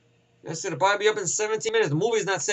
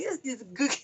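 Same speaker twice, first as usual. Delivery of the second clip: soft, higher mock voice; imitating the kid; 6 semitones higher, 8 dB quieter than usual. Kid answering Renner seriously, soft high mock voice. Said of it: "he goes like".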